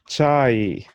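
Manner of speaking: "Chai" with a clear ch at the start; teacher models "Chai" slowly, ch then long ai; Swahili ch sound. Shown as a demonstration. Teacher modelling a Swahili word for the learner, clear demo. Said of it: "Just like the way we said earlier".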